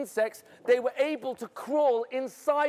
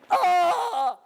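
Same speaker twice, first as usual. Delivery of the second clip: sudden high scream of fright; short loud terrified scream; 7 semitones higher, 4 dB louder than usual. Acted. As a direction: yelp out in terror.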